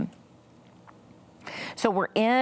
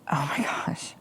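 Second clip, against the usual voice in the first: soft, near-whispered dismay; hushed thought; 4 semitones lower, 4 dB quieter than usual. Retold or imitated. Mother recalls realising her daughter is upset, hushed. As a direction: let it out almost under your breath.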